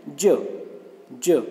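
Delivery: ज spoken as a careful sound demo; ज्ञ demo. Shown as a demonstration. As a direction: say ज clearly twice. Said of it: "इसका उच्चारण हमें क्या करना है". (ज का साफ़ उच्चारण)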